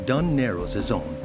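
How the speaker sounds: ordinary talk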